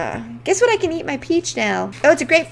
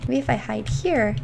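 normal talking voice

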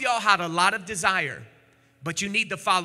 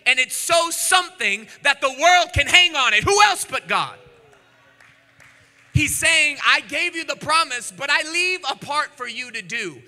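shouted, higher and louder preaching; voicing God, the preacher roars at the crowd; preaching at full force; 5 semitones higher, 8 dB louder than usual. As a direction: build into a fiery climax as God speaking. Preaching climax with music.

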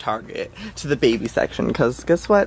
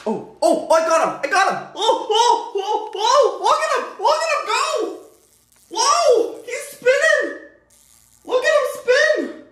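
excited shrieking. Real, not acted. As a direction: squeal with triumph and laughter. Caught the fly, shrieking.

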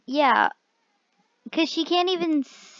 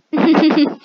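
burst of laughter; 3 semitones higher, 10 dB louder than usual. Real, not acted. Laughs after joke.

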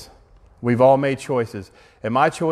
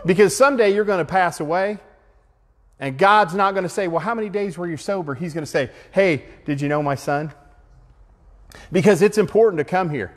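raised, urgent preacher's exhortation; rally the room to reach out; fervent preaching; 4 semitones higher than usual. Acted. Preaching at full force to group.

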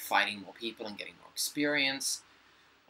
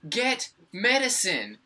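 high, loud exasperation; incredulous, can't believe it; exasperated; 10 semitones higher, 7 dB louder than usual. Real, not acted.

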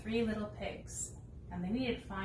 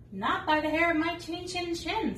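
much higher, louder piggy voices; little pigs voice; 8 semitones higher, 7 dB louder than usual. Acted. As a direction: squeal it high and defiant. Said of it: "said the pigs".

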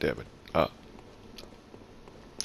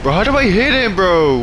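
higher, louder exasperated shout; frustrated yell; 9 semitones higher, 6 dB louder than usual. Real, not acted. Player asking friend for help, frustrated yell. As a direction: cry out in real frustration.

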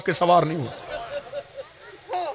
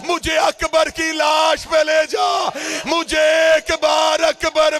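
full-force lamenting sermon delivery; shouted plea of a grieving father, recited to mourners; impassioned majlis recitation; 8 semitones higher than usual. Acted.

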